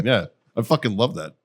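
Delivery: normal talking voice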